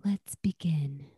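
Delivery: soft whispered spa voice; masseuse whisper. Retold or imitated. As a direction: breathe it like a calm masseuse. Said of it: "corporate masseuse voice".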